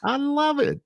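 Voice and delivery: squealing with delight; gushing delight. Real, not acted. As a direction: rave about the boots. Admiring guest's boots.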